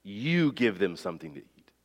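YOU stressed, the rest falling away softly; soft but pointed stress on YOU; emphatic you. Acted. Jesus telling the twelve to feed the crowd, stress on YOU. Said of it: "is emphatic".

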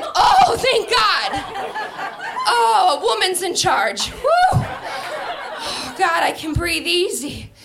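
high, loud, overjoyed relief; relieved excitement. Retold or imitated. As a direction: gush with exaggerated relief. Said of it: "And I was like".

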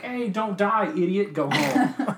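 high, slow, laughing British scold; a gentle mock-English telling-off; mock British cop. Imitating the cop's mild English scolding, laughing. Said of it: "such a".

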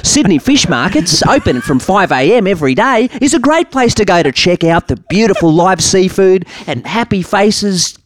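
high, bouncy travel show presenter; TV host voice. Pretend. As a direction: sell it like a cheesy tour host.

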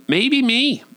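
high, sheepish little admission; coy confession. Acted. Comic owning up to saying such a thing, coy. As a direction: sing it up high, playfully guilty.

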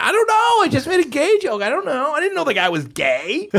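imitating a flustered, very high-pitched denial; panicked excuse. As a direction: act out someone frantically pleading ignorance. Performer panics, defends himself, retold.

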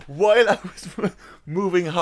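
laughing through the words. Real, not acted. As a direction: try to get it out while giggling. Giggling while trying to answer.

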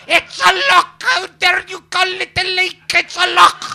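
high, loud, hammed-up Scottish outrage; indignant Scot. Offended Scot correcting the comedian, loud mock Scottish accent. Scots correcting "lake" to "loch.

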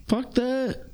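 dismissive. Retold or imitated. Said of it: "I would just be like".

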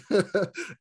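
short real laugh. Real, not acted. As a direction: laugh it off lightly. Laughing over butchered papers.

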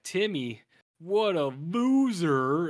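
loud, high, drawn-out jeer; mocking scorn. Brother reading Timmy's valentine, loudly scornful. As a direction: stretch it out in hammed-up derision.

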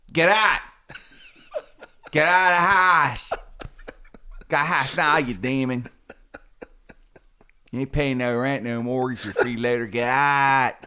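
hammed-up exorcist commanding with a Pittsburgh twang. Shown as a demonstration. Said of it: "my impression of a Pittsburgh exorcist".

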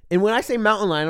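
usual speaking voice

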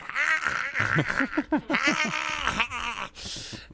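high wide-open cackle; open-mouthed drunk laugh. Retold or imitated. Imitating old man's open laugh.

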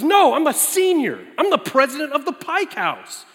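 mock-outraged big guy, loud and high; indignant Jeff. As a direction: play him offended and booming. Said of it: "he goes".

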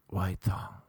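breath-only seductive title read; sexual whisper. Shown as a demonstration. Podcaster trying a sexy poem opening, whispered. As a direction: whisper it as a mock come-on. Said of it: "let's make it sexual".

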